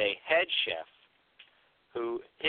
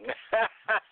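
real laugh; 10 semitones higher than usual. Real, not acted. Friends joking.

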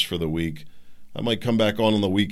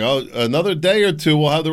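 louder mock official excuse; 6 semitones higher, 6 dB louder than usual. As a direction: brush it off like a dismissive official, louder. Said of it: "they're like".

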